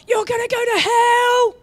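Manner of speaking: high, loud shouted condemnation; a loud, high shout of doom at a stranger; mock hellfire shout. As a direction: yell it at them like a street preacher. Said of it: "screaming and shouting it at someone's face".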